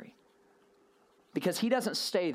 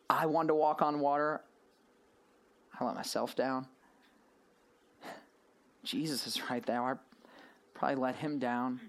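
dejected inner voice; 5 semitones lower, 4 dB quieter than usual. Retold or imitated. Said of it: "one of the thoughts in his head was".

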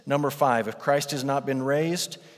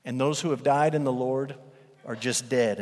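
ordinary talk, nothing marked

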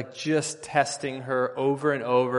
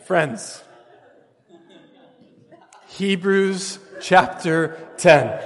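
slow, laughing through the words; 5 semitones higher, 9 dB louder, much slower than usual. Real, not acted. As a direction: recover from laughter while announcing. Still laughing from story.

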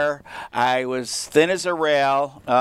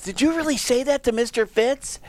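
put-on high-pitched eager kid voice; much higher, like kids checking a rumour; incredulous students; 9 semitones higher than usual. Students running into Joe's class to ask, incredulous. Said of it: "then they run into his class".

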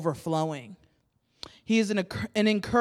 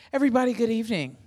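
game show host; 4 semitones higher, much faster than usual. Shown as a demonstration. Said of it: "wanna do the game show voice too".

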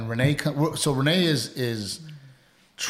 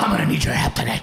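fast, raised mock demand; exaggerated imitation of wife; 4 semitones higher, 5 dB louder, faster than usual. Husband mimicking wife's demand to needle her, mocking imitation. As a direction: blurt it as a hammed-up nagging partner. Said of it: "my voice did not sound like that".

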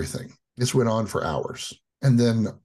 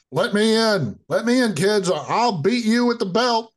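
mock angry dad; 11 semitones higher, 3 dB louder than usual. Dad pounding on the door.

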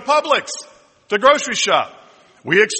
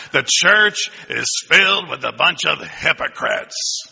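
slow, drawn-out mock complaint; hammed-up voice of a church critic. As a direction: put on a grumbling critic, dragging each word. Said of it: "You gotta say it like that".

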